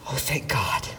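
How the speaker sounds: a whispered thank-God of relief; relieved whisper. Retold or imitated. Father finding his lost son, whispering in relief. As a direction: breathe it out as a voiceless whisper.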